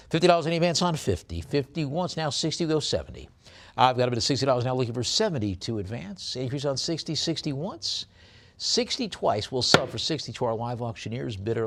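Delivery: quick, punchy bid calling, pitch jumping up on once and twice; auctioneer's call. Acted. Auctioneer calling bids to the online bidders, brisk and rhythmic. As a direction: rattle off the bids like a live auctioneer. Calls bids on coin lot.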